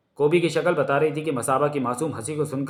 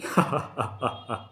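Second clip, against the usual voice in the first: helpless "ha-ha-ha" laugh; acted hearty string of laughs; bursting laugh; 5 dB quieter than usual. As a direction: break into laughter despite yourself. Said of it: "कोबी की हंसी छूट गई".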